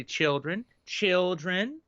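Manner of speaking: coaxing the class, climbing in pitch; gently pleading children. Retold or imitated. Teacher coaxing a noisy class, rising pleading call. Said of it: "if they just say".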